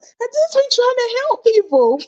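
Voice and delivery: high, far-off pleading whine; high whiny voice insisting she only helps; whiny self-mock. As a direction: whine like a wronged helper. S1 mocks herself in whiny voice.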